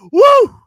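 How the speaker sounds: high, loud "woo"; sudden high-pitched cheer; mock whoop. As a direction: let out a piercing whoop. Mock reaction to brown hordes worshiping.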